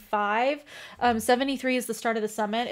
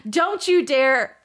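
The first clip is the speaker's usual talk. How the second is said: fierce defiance; 3 semitones higher, 6 dB louder, slower than usual. Acted. Bryce defying in read scene.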